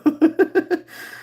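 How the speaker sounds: high giggle; real laugh, pitched high. Real, not acted. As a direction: giggle after the thanks. Laughs after thanking the viewers.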